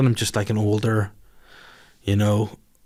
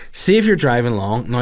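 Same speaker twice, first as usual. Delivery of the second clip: ordinary delivery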